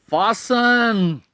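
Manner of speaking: फासन said slowly with no t sound, fah-sun; drawn-out फासन, the t left silent. Shown as a demonstration. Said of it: "इसमें हम टी नहीं बोलते".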